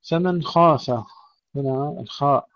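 usual speaking voice

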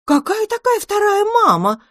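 very high amazed squeal; cat squeals in amazement at the second mum. Acted. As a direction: shriek it in disbelief. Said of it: "изумилась кошка".

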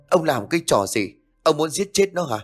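understated indignant outcry; alarmed protest. A narrator's reading. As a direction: challenge him in mild panic. Mother protests as baby is grabbed.

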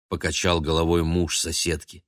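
ordinary delivery, nothing marked

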